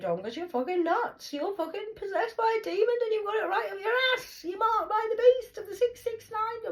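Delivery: very high, sing-song caricature; mock crazed rant. Taunting someone as possessed and nuts, high crazed caricature. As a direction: chant it in a high, loony voice.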